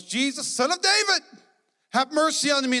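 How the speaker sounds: desperate cry. Acted. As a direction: cry out to be heard over the crowd. Blind man crying out to Jesus.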